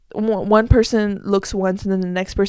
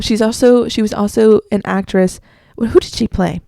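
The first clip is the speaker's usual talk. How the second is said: normal talking voice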